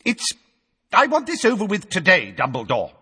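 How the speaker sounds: high, loud impatient minister; flustered official demanding an end; blustering. A narrator's reading. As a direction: push to end it now. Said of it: "blustered Fudge".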